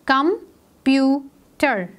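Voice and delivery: slow "कंप्यूटर" as com, pu, ter; split into syllables. Shown as a demonstration. Teacher counting three syllables for pupils, syllable by syllable. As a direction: pause between the three parts of "कंप्यूटर". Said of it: "we have three syllables here".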